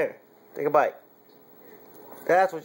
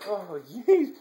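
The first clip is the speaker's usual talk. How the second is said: ordinary talk, nothing marked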